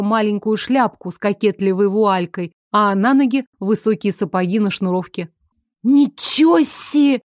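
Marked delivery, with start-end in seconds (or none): 5.8-7.2 high, slow amazed gasp; awed creature voice. A narrator's reading. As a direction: breathe it out in awe. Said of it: "восхищённо выдохнул Шо".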